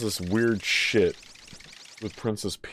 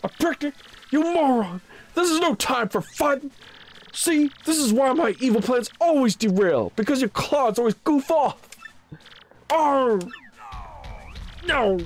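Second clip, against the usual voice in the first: Bowser; 13 semitones higher than usual. Acted. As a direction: bellow like an annoyed king koopa. Bowser rages about picnic.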